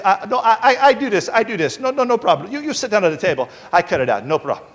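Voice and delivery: mock Indian accent, stammered reassurance; imitated Indian doctor. Doctor telling patient to sit on the table, mock Indian accent. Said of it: "she talked like this".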